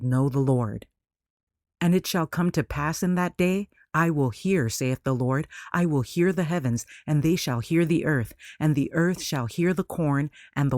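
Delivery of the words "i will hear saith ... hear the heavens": usual speaking voice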